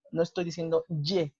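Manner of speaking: usual speaking voice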